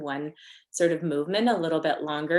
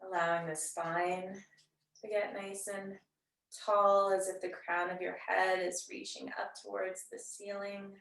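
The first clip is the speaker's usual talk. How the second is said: soft, unhurried yoga-teacher voice with long pauses; guided meditation; 11 dB quieter than usual. Real, not acted. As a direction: gently guide them into settling and noticing the breath. Leads seated breathing meditation.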